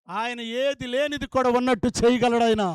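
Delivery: loud, ringing pulpit climax; booming sermon climax; preaching at full force. Acted. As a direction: drive the promise home.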